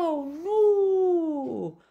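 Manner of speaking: long, very high loud wail; howl of pain. A narrator's reading. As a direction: wail out in agony. Lion bitten all over. (urlet lung de durere)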